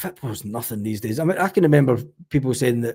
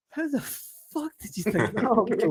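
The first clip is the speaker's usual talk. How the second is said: amazed disbelief; 9 semitones higher, 5 dB quieter than usual. Retold or imitated. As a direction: marvel at the cheek of it. Driver's amazed reaction quoted.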